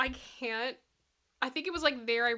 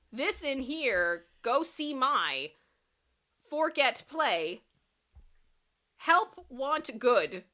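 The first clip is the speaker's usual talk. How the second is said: slow reading; slower than usual. Real, not acted. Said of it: "knows what this says".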